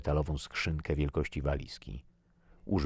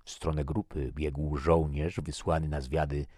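ordinary delivery, nothing marked

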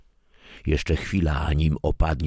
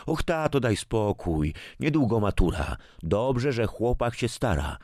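a touch higher, patient daughter; lightly voiced daughter; 7 semitones higher than usual. A narrator's reading. Daughter patiently reminds her father, lightly voiced higher. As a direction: explain gently to an old father.

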